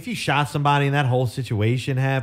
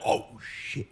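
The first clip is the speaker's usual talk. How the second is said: breathless unvoiced whisper, caught off guard; whispered oh shit; 9 dB quieter than usual. Sneaking into the room and finding the intruder, a startled whisper. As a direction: hiss it so nobody wakes.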